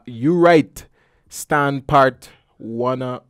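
slow, halting read of unfamiliar spelling; struggling to read. Real, not acted. Host stumbling over a tweet typed in Bajan, slow and halting. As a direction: sound out each word slowly, unsure. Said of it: "and I can't read it".

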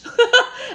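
sudden high giggle; high laugh. Real, not acted. Laughs at own story.

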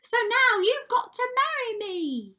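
very high, loud squealing sister; triumphant scream. A narrator's reading. Ugly sister claiming the prince in marriage, triumphant scream. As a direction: shriek with glee as the ugly sister. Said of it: "But she screamed".

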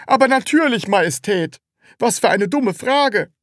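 high, loud, impatient answer; exasperated seller. A narrator's reading. Seller answers the Sams's silly question, exasperated.

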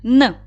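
"Н" as one short consonant; quick "Н" on its own; isolated sound. Shown as a demonstration. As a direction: sound out "Н", not the letter name. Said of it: "виконаємо звуковий аналіз слова".

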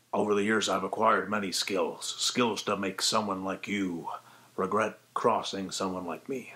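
low, menacing Liam Neeson threat; lightly voiced grim Liam Neeson; Liam Neeson impression. Shown as a demonstration. Voice tutor demonstrating a Liam Neeson impression. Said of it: "your very own Liam Neeson impression".

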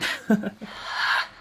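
short breathy burst of laughter; amused laugh at the game. Real, not acted. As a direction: laugh at the surprise. Streamer laughs at new blade.

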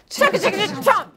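chugging noise. Shown as a demonstration. Eating noise in story.

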